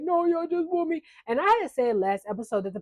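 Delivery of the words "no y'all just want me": high-pitched tearful retold wail; mock crying. Retelling a crying contestant's protest, mock crying. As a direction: imitate him crying, voice pushed up high. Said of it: "he starts crying because he's just like".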